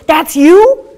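loud, high incredulous question; astonished boy. Retold or imitated. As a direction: act out a kid's amazed double-take. Retelling boy's astonished reaction.

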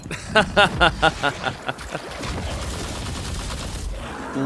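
long, soft voiceless chuckling; quick run of airy laughs, dying away; wheezy laughter. Real, not acted. Viewer loves the impression, long airy laugh.

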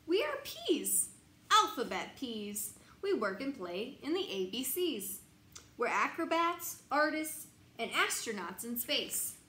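lively, bouncy picture-book reading; reading to children. Acted. Parent reading a picture book aloud at bedtime, lively. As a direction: read it out brightly for a little one.

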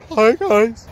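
high, strained mock no-voice; mock lost voice. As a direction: pretend the voice is gone. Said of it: "Tomorrow I'll be like".

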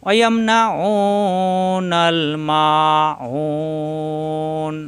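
slow, drawn-out chanted recitation; Quran recitation. Shown as a demonstration. Teacher reciting Quran verse for students, slow chant. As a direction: recite with long tajweed stretches.

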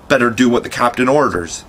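ordinary delivery, nothing marked

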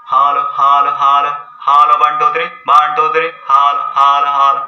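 loud mic test through a megaphone; megaphone mic check. Shown as a demonstration. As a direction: call out a test count into the megaphone. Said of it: "मैं आपको करके यहाँ पे दिखा रहा हूँ". (Megaphone में ज़ोर से माइक टेस्ट)

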